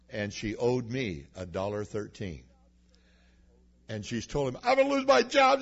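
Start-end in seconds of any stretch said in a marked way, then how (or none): 4.6-5.6 much higher, louder, near tears; panicked cashier. Retold or imitated. Young cashier fears losing her job, high panicked cry. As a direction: blurt it out in a panic, voice cracking up high. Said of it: "She started crying".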